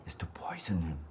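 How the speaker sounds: a ghost's sinister whisper; scheming whisper. Acted. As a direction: breathe the poison plan conspiratorially. Ghost plotting murder in cave.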